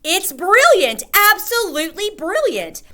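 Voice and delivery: grandmother overjoyed at the plan; delighted shout. A narrator's reading. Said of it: "and she shouted".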